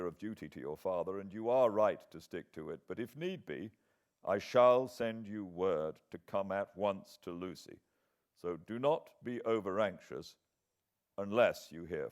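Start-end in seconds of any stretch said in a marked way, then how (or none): none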